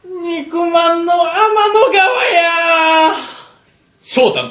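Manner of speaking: high, long drawn-out comic cry; hammed-up wail. Co-host giving his silly catchphrase, high comic wail. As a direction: hold each vowel in a silly high wail.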